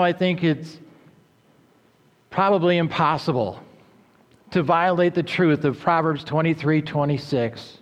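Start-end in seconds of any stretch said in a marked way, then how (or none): none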